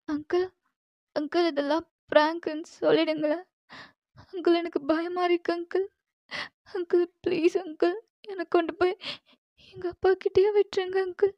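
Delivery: high, soft, trembling plea to let her go; frightened girl pleading. Acted. Captive girl begs Jimman to send her home, frightened pleading. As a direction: beg your captor like a scared young girl.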